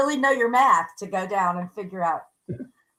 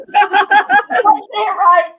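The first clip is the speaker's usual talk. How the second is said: laughing; 10 semitones higher, 9 dB louder than usual. Real, not acted. Repeats question, laughing.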